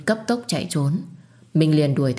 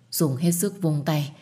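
usual speaking voice

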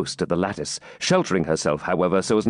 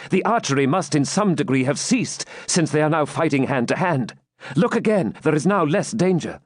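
lightly raised, insistent voice; a touch of eagerness; urging wounded knight; 6 semitones higher than usual. Acted. Knight persuades maiden it is safer, urging.

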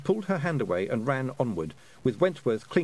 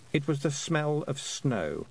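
ordinary delivery

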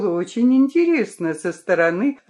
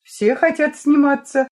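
higher, louder snap; curt cut-off; 7 semitones higher, 4 dB louder than usual. A narrator's reading. Vika dismisses Lyolya's wish, curt and loud. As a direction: shut her down in one line. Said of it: "отрезала Вика".

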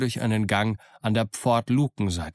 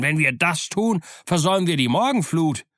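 higher, louder protest; calling out; 6 semitones higher, 3 dB louder than usual. A narrator's reading. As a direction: argue against it out loud. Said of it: "rief Long John". (lauter, höherer Einwand)

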